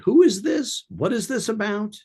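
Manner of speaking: high, sharp voice of a wary person picking up the phone; wary homeowner, high and guarded; suspicious stranger. Retold or imitated. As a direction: play the suspicious homeowner answering a cold call. Imitating a wary seller on the phone.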